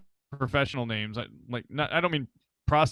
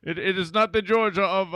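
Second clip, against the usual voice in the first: put-on Russian accent; 5 semitones higher, 3 dB louder than usual. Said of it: "totally different persona you gotta take on".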